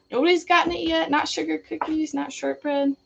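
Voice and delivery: excited. Real, not acted. Calling out wrong guesses.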